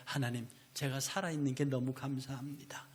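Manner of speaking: soft hushed thanks to God; whispered prayer. Retold or imitated. Believer praying to God, whispered grateful thanks. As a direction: pray it under your breath, intimately.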